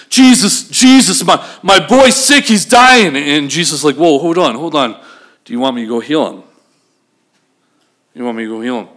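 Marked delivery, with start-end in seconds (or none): 0.1-3.2 high, loud, stammered plea; urgent panic. Acted. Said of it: "the other way you could read it is". 4.0-8.9 check, taken aback, what is being asked; questioning response. Shown as a demonstration. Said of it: "the other way you could read it is".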